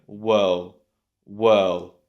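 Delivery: Whirl, no R, vowel gliding into L; L joined on. Shown as a demonstration. Teacher building world without the D, L joined on. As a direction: say whirl as one syllable ending on L. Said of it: "pronounce the L together".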